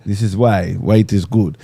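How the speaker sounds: slow, lightly put-on Russian accent. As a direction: say it with a hint of Russian. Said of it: "why did you have a, a, a Russian accent?".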